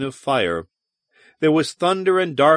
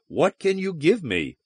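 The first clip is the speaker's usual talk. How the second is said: ordinary delivery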